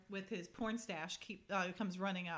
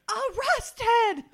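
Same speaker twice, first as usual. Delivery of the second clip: high, loud mock shriek of "Arrested!"; imitating her shocked shout on the phone; 13 semitones higher, 16 dB louder than usual. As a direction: scream it in disbelief. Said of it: "She's like".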